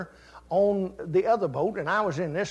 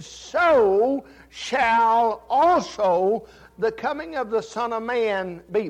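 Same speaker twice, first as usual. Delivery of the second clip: drawn out; 4 semitones higher, 6 dB louder, much slower than usual. Acted. Verse repeated, drawn out.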